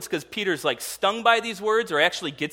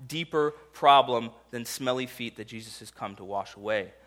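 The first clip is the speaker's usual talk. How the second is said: normal talking voice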